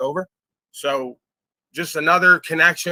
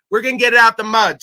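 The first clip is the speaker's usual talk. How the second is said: higher and louder, fired-up promise; loud vow; 6 semitones higher, 6 dB louder than usual. Real, not acted. Streamer vowing to his viewers to expose frauds, shouted. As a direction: punch it out like a rally cry.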